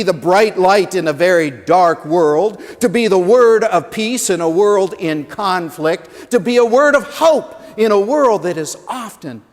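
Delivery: loud, building sermon voice; rally the congregation to action; rousing preaching. Acted. Pastor rallying the congregation to be light, preaching loud.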